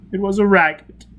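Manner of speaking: high, loud, broken admission; pained admission. A narrator's reading. Prisoner admits the racket to Foyle, pained. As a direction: admit it in anguish.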